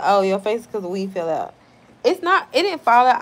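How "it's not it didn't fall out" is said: loud, high-pitched protest; indignant denial. Real, not acted. Streamer denies her hair fell out, indignant. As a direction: push back loudly, really offended.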